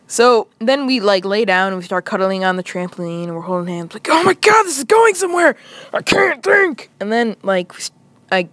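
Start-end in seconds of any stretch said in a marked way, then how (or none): 4.1-6.9 high, loud inner freak-out; giddy mock panic. Boy to himself holding hands on trampoline, giddy panic. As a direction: squeal it in flustered excitement.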